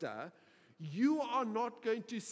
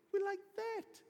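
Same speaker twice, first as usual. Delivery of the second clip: much higher, acted protest; incredulous insistence; 12 semitones higher than usual. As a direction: insist in high-pitched disbelief. Peter protests Jesus's prediction.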